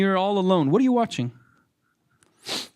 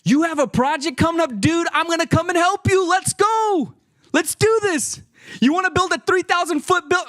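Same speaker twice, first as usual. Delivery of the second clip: overeager hype; 10 semitones higher than usual. Retold or imitated. Eager friend offering to help with a project, pumped-up hype. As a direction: hype him up like a cheerleader.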